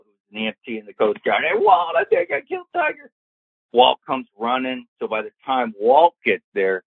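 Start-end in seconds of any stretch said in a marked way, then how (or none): 1.4-3.1 high, panicked call for help; retold scream. Golfer screaming to his friend that he hurt Tiger, high retold scream. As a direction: yell it high across to a friend. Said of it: "I screamed to Walt".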